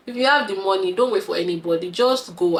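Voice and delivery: usual speaking voice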